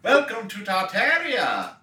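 high, slow showman welcome; grand announcer voice. Pretend. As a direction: announce it like a theme park host. Playful tour-guide welcome.

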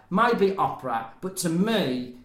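ordinary talk

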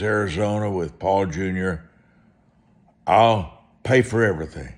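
normal talking voice

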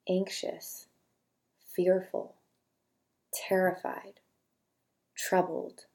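quiet, unhurried reading of single words with long pauses; soft list reading. Acted. Host reading feeling words aloud for listeners to note, soft and slow. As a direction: let each word land softly, one at a time.

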